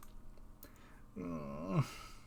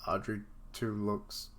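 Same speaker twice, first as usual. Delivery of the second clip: usual speaking voice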